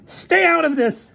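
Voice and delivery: high, slow, forceful warning; angry snap. Acted. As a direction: snap at someone butting in. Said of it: "snapped Featherstone".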